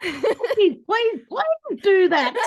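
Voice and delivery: high, loud, drawn-out pleas; hammed-up gushing, stretch out each please; enthusiastic pleading. Speaker re-enacts cheering on an audience member's idea, enthusiastic pleading. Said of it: "That's what I would have said".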